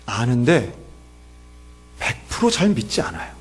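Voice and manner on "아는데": ordinary delivery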